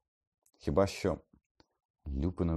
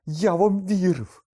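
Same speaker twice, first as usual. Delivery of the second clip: slow, high, very loud cry; voice breaking, furious; 12 dB louder, slower than usual. A narrator's reading. Harry feels betrayed by Lupin, furious breaking shout. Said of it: "Його голос зривався від хвилювання".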